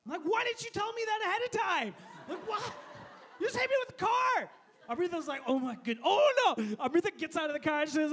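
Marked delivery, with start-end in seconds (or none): 0.2-4.5 high, loud outraged protest; indignant shouting. Acted. Man just hit by a car, indignantly shouting at driver. Acted retort after being hit. 5.5-6.8 mock alarm building to a loud cry; alarmed exclamation. Wife watching husband get hit, exclaiming in mock alarm.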